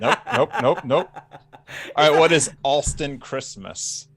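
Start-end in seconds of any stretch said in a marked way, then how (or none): 0.0-1.1 laughing nope. Real, not acted. Laughing denial of Minion joke.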